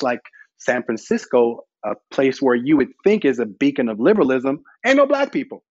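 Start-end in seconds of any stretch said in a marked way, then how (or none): 4.8-5.6 high mock exclamation. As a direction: toss it off in a high mocking pitch. Punchline about San Francisco.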